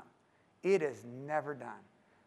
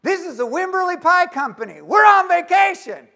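much higher, shouted, gleeful; mock answering machine; 13 semitones higher, 15 dB louder than usual. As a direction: boom out the message like a rodeo announcer. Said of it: "what's on his answering machine".

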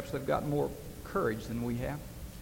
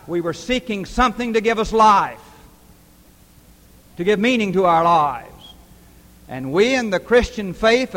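louder, driving preacher's cadence; preaching at full force; 6 semitones higher, 10 dB louder than usual. Acted. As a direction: build the sermon to a ringing climax. Sermon climax building loud.

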